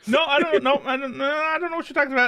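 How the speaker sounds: squeaky, fast protest; high fake denial. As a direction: play it guilty, high and fast.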